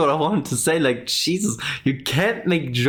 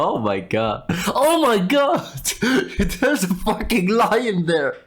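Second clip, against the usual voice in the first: slow, higher, laughing through a mock shriek; laughing girl impression; 5 semitones higher than usual. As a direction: squeal it while cracking up. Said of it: "Girls would go".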